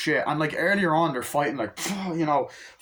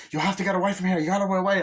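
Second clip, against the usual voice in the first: higher-pitched imitated urgent plea; urgent warning; 7 semitones higher, 4 dB louder, much faster than usual. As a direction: act out warning someone to flee. Retold urgent warning from film.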